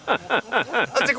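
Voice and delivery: ordinary talk, nothing marked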